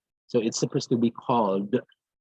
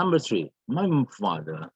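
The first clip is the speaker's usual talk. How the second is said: ordinary delivery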